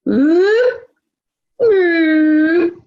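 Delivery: high rising whoop, twice; whooping wave noise. Retold or imitated. Rescue swimmer imitating the wave that swept him, high whoops. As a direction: imitate the wave lifting you up.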